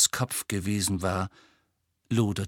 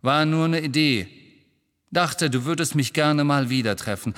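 loud, high, hurt retort; piqued, defensive outburst; displeased; 6 semitones higher, 5 dB louder than usual. A narrator's reading. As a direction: throw it back, offended. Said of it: "Er wirkte äußerst ungehalten".

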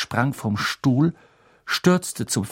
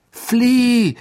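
high, loud cry; shouting; 13 semitones higher, 9 dB louder than usual. Acted. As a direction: yell at him to go. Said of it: "riefen Harry und Hermine". (lauter Ruf)

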